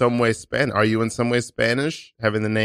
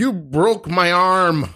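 slow, higher-pitched wail of complaint; pained accusation; 6 semitones higher, much slower than usual. Acted. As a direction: whine it out as if badly hurt. Improv injured victim accuses attacker.

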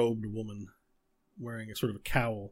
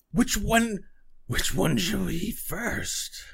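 higher, slow relishing crone voice; hungry old hag; 9 semitones higher, 4 dB louder, slower than usual. Acted. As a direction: savour the question like a witch picking her meal. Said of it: "She goes".

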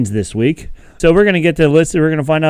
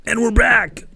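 excited; 10 semitones higher, 3 dB quieter, slower than usual. Acted. Back from commercial break announcement.

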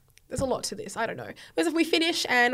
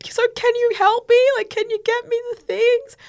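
pleading daughter; 11 semitones higher, 8 dB louder than usual. Retold or imitated. Pleading with dad.

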